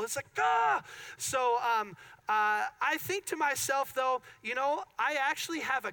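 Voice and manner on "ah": loud, high "Ah!" of dismay; frustrated cry. Retold or imitated. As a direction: cry out at how bad it is. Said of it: "So that's just terrible".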